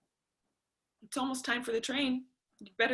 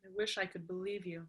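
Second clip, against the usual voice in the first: quiet hurt; 5 semitones lower, 12 dB quieter than usual. Acted. Daughter after mother's confession.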